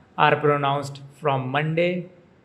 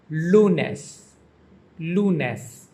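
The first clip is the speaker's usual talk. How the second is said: "lunes" with a strong first syllable, LU-nes; stress on first syllable; 2 semitones higher than usual. Shown as a demonstration. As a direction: hit the LU in "lunes", then let it fall. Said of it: "emphasis on the first syllable".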